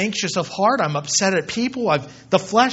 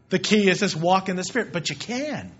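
normal talking voice

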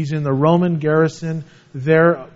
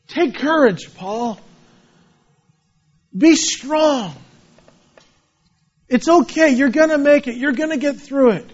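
gentle higher voice of comfort; reassuring; 6 semitones higher than usual. Acted. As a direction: lift him up tenderly. God comforting Paul.

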